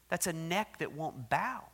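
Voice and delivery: ordinary talk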